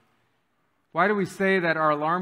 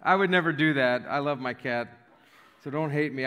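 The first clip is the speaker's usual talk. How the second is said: ordinary delivery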